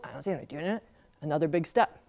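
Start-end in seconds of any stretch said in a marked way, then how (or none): 0.0-0.8 much softer, small scared voice; a frightened kid's hushed reply; timid. Acted. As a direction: answer barely daring to speak. Scared little sister answering.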